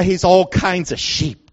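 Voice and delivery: ordinary delivery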